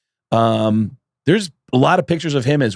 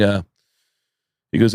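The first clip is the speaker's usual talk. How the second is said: usual speaking voice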